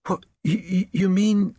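high, stammering disbelief; shocked stammer. Acted. Son grasps that the donkey may be killed, shocked stammer. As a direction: stumble over the words as the awful truth dawns.

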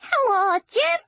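granny voicing her false teeth, squeaky. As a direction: make the dentures talk in a squeak. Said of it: "in the squeaky voice she always used for the teeth".

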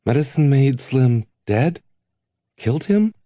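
the truth hits him; horrified disbelief. A narrator's reading. Length realises Slim was killed.

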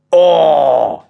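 loud, altered badger voice; a long, loud oh of wonder; amazed oh. All the other badgers hear of the find, amazed oh. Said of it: "machten alle anderen Dachse".

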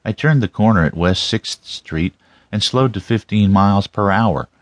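ordinary delivery

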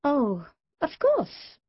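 sweet as pie, with a dig; mock-sweet and drawn out; sweetly. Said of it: "I said sweetly".

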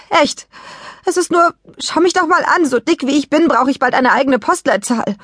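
high-pitched, exasperated self-pity; flustered complaining. A narrator's reading. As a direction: pregnant mother venting her woes. Pregnant mother fends off concern, complains.

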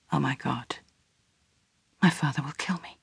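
put-on young man learning his girl is pregnant; hushed dread. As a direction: let the fear drop into a hush. Edwin shocked by pregnancy news.

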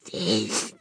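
intense whisper; very soft, with fierce feeling held in; barely audible intensity. A narrator's reading. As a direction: whisper it with all your force. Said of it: "so quietly it could not be heard".

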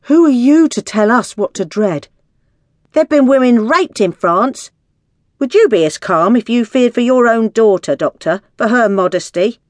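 high, loud indignant challenge; shouting out. A narrator's reading. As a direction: shout accusingly from an angry crowd. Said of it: "The women all shouted out at once".

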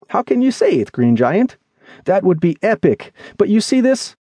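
high-pitched character voice in protest; doubting, agitated friend. Acted. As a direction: object earnestly, pitched well up.

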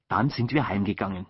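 ordinary delivery, nothing marked

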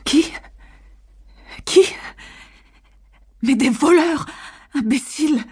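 panicked and high-pitched; a mild frightened outburst at her husband; frantic. A narrator's reading. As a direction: shriek it in a frenzy of fear. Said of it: "Elle frémissait".

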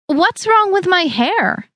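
high, slow whine; a bridesmaid whines about her hair, high and slow; whining. A narrator's reading. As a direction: whine it in wounded protest. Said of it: "I whined".

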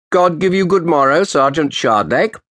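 put-on old official's sharp greeting. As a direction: snap out a cold greeting to the lawyer. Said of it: "he said in his sharp voice".

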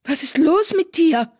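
high-pitched, affronted outburst; indignant hiss. A narrator's reading. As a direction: demand it in shocked anger. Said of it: "zischte sie".

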